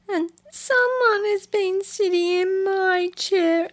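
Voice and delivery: high squeaky little bear voice; bear cub. Acted. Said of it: "said the little wee bear".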